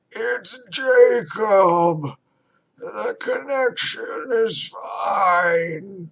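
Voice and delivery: very slow, high, loud warped cry; distorted monster voice. A narrator's reading. Creature Jacob reassuring Rebecca, wailing. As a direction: stretch each word into a wail.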